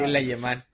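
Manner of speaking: "alemán" said "ayemán" through laughter; mocking slip on "alemán"; mimicked mispronunciation. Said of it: "Le empezaron a decir".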